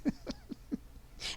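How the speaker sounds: soft, high-pitched giggle; breathy chuckle that tails upward; amused laugh. Real, not acted. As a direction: giggle quietly at the joke. Amused at Merlin's joke.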